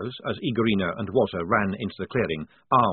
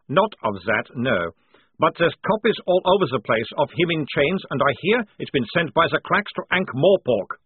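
put-on higher, louder accented prisoner; informative sergeant; 6 semitones higher, 5 dB louder than usual. A narrator's reading. Prisoner reporting the circulated pictures, cocky and accented. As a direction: breezily spread the gossip in accent.

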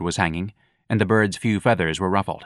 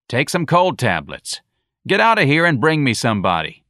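gruff order; 6 semitones higher, 4 dB louder than usual. Acted. Sergeant dismisses him.

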